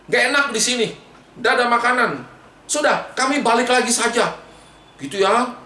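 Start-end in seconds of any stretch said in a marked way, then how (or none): 0.1-4.3 high-pitched fed-up complaint; acted cross refusal; angry grumbling. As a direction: snap at Moses in protest, pitch raised. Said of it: "mereka marah-marah kepada Musa".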